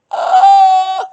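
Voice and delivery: loud, high, drawn-out oh; sudden dawning shock; loud slow oh of realisation; startled realisation. Real, not acted. Realisation about sales script.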